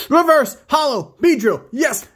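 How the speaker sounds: gasping, then shouting it high and loud; excited gasp. Real, not acted. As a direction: blurt it out, thrilled by the pull. Gasp at a rare pull.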